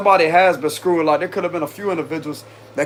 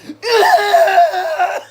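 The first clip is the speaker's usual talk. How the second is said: a long, high, loud mock noise; Lloyd's annoying sound; 5 dB louder than usual. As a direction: do the most annoying sound in the world. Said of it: "like that".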